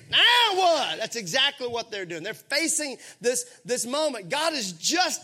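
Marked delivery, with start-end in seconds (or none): 0.1-1.0 high, loud, indignant; exasperated shout. Shown as a demonstration. Preacher leading the congregation in a loud indignant shout. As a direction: belt it out in disbelief. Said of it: "everybody on the count of three".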